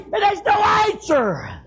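high, slow wail of narration; full-force preaching. Real, not acted. Preacher tells of the empty house, drawn-out anguished shout. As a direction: stretch it out high, then let it fall.

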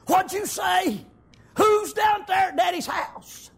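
high, loud, drawn-out astonished questions; can't believe what he just heard; startled disbelief. Acted. David stunned by the news.

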